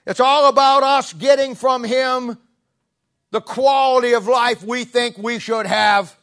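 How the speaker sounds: rising to a loud, high preacher's cry; preaching at full force. Real, not acted. Preacher to congregation, loud high proclamation. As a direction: lift it high and hard to the congregation.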